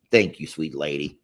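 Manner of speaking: usual speaking voice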